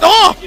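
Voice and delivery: loud high "oh!"; startled yell. Real, not acted. Player caught off guard by an attack, startled yell. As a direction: cry out in alarm.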